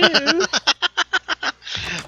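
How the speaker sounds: high, loud burst of laughter. Real, not acted. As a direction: crack up laughing. Laughing at the imitation.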